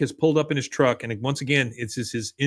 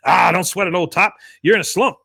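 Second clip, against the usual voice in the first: higher, louder imitated boss; breezy boss; 5 semitones higher, 6 dB louder than usual. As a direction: brush off an employee's worry with volume. Boss brushing off worker, retold.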